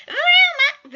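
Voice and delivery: loud, high call bursting through the door; sudden loud shout of a name. A narrator's reading. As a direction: yell the name as the door flies open.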